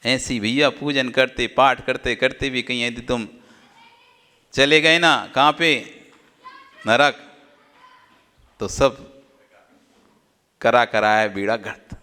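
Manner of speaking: ordinary talk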